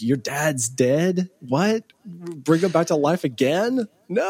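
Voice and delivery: high, slow, bewildered mock reaction; shocked kids. Boys freaked out by the comments, high and bewildered. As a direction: play the startled boys hearing the news. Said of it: "And, like".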